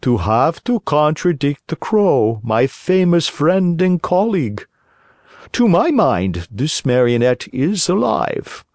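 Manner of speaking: owl doctor. A narrator's reading. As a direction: contradict him like a fussy owl. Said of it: "said the owl".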